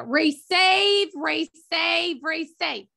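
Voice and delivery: Receive stretched with a Southern twang, pitch up; like Julia. Retold or imitated. Imitating Julia's Southern twang for the moderator, lightly done. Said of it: "you gotta say it like Julia".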